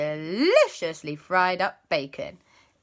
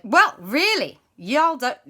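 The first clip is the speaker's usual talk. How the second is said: put-on accidental American accent; 8 semitones higher, 7 dB louder than usual. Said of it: "wait, that's American".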